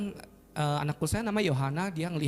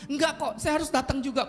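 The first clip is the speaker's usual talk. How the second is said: retold stubborn plea, higher pitch; higher-pitched, pushy insistence; insistent; 9 semitones higher than usual. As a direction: imitate a visitor who won't be refused. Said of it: "Dia ngotot".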